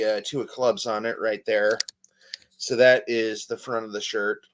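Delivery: ordinary delivery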